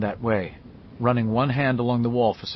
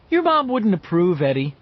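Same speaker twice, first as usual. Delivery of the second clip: plain reading voice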